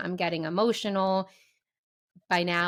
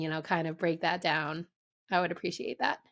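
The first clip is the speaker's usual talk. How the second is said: normal talking voice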